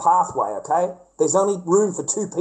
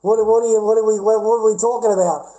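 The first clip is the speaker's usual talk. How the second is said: higher and louder, baffled protest; retold indignant yell; 4 semitones higher, 5 dB louder than usual. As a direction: shout back in disbelief. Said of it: "I'm like".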